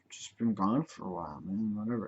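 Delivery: dazed mumble. Retold or imitated. Sick friend on morphine.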